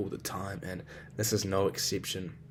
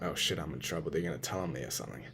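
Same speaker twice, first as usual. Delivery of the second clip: low, soft muttered worry to himself; retold worry; 6 semitones lower, 8 dB quieter, faster than usual. Ten-year-old fearing he'll be told on, low understated worry. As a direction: play a kid quietly realising he's in trouble. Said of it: "and I'm like".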